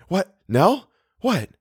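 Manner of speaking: high, quick bewildered questions; startled. A narrator's reading. Theodora waking to Eleanor's shout, startled. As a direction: blurt it, jolted and confused. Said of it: "startled and disheveled".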